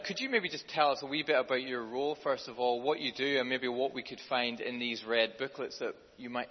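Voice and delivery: normal talking voice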